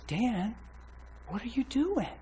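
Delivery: high, soft imitation of a girlfriend; imitated concerned girlfriend. Retells her troubled, pleading question.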